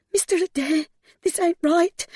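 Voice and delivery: high-pitched, anxious pleading; frightened protest. A narrator's reading. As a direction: timidly beg the person to stop.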